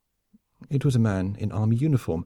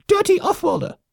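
very high, loud squeaky insult; barked; 5 dB louder than usual. A narrator's reading. Lemming officer insulting the offworlder, barked. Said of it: "the officer barked".

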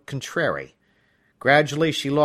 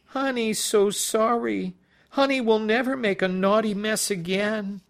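put-on childish baby voice; 10 semitones higher than usual. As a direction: play the judge as a simpering little child. Judge in baby role voice.

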